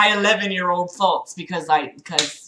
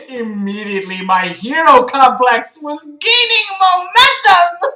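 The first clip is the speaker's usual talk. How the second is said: mock grandiose; 5 semitones higher, 4 dB louder than usual. Reader mocking the hero complex line, mock grandiose. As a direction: build it to a ridiculous heroic swell.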